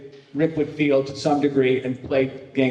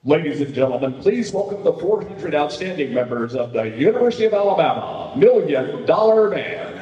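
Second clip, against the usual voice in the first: big stadium introduction, rising and stretched; announcer voice; 2 semitones higher, 4 dB louder than usual. Shown as a demonstration. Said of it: "give us just a snippet of your announcer voice".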